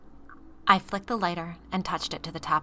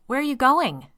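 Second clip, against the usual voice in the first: loud, higher call after her; 9 semitones higher, 10 dB louder than usual. Acted. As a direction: shout the question across the yard. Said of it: "Lucky called out".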